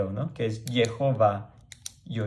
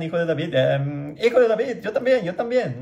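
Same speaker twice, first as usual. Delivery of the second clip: much higher, louder imitated crowd cry; crowd shouting; 6 semitones higher, 4 dB louder than usual. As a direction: call out like a desperate crowd begging to be included. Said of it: "y todos le gritan". (grito agudo imitando a la multitud)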